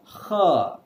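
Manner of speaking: "h" drawn out as a throaty "khaa"; French R sound. Shown as a demonstration. Teacher repeating the French R for learners, throaty rasp. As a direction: blend ha and kha into one "h". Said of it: "R is spoken as".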